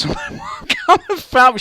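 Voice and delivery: high laughing burst through the words. Real, not acted. Telling friends the end, laughing high. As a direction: laugh it out at your own folly.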